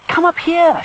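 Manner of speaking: high, loud beckoning call. A narrator's reading. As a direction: call a friend over from a distance. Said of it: "she calls to the little bear".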